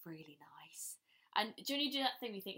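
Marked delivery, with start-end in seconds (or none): 0.0-1.0 hushed guilty whisper. Retold or imitated. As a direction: breathe it like a confession. Secret hope dresses look bad.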